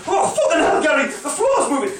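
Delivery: high, loud startled yell; alarmed shout. Retold or imitated. Drunk punter shouts to Gary, spooked. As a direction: shriek about the moving floor.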